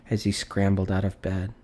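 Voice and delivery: ordinary delivery, nothing marked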